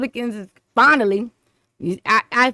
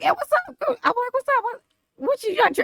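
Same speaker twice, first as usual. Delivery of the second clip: much higher, squeaky party greeting; retold greeting; 13 semitones higher, 4 dB quieter than usual. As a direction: mimic yourself calling out at the party.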